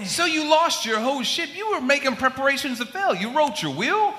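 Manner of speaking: high, loud scolding captain. Acted. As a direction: chide him for preparing to fail.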